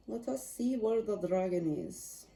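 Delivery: playful mock Russian accent, trailing off to a whisper; a light, joking Russian accent. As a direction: ham up a Russian accent as you hunt for the card. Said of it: "I just did a Russian accent".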